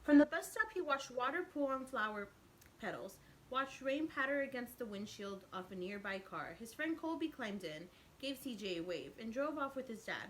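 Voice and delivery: lively storybook narration; teacher reading a picture book aloud; reading to children. A narrator's reading. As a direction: read it out to the kids with expression. Reads picture book to class.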